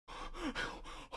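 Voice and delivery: sharp shocked breathless gasp. Real, not acted. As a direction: suck in air in pure shock. Shocked at giant's death.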